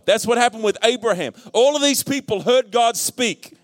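high-pitched, building sermon climax; rousing preaching. Real, not acted. Preacher urging congregation to hear God speak, rousing preaching. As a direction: preach it in rising, insistent repetitions.

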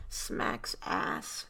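normal talking voice

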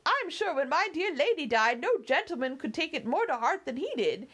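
put-on high, chattering old housekeeper voice; fussy old servant. Housekeeper nagging her young mistress to come inside, high fussy voice. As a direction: fuss and scold like a doting maid. Theresa coaxes Emily indoors.

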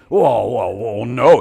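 loud, stumbling dim protest; mock flustered reply. Excuse-maker answering the preacher, flustered and stammering. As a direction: stall on each word, caught out. Acted sheepish answer to the challenge.

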